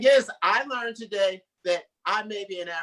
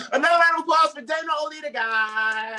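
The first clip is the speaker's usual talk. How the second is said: loud, fast applause call; host shouting; 8 semitones higher, 6 dB louder than usual. Real, not acted. Host shouting to the crowd for applause. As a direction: pump up the crowd.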